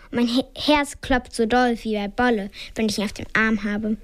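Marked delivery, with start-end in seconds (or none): none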